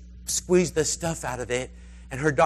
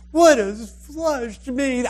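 high, drawn-out dismayed question; exaggerated baffled squeal of a question; 9 semitones higher, 5 dB louder, much slower than usual. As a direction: stretch it out in mock bewilderment. Woman baffled by magazine term.

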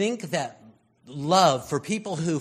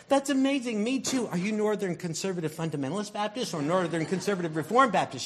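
high, fast and gushing; thrilled; 6 semitones higher, faster than usual. Acted. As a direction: squeal with delight at each match. Excited believer questioning a stranger.

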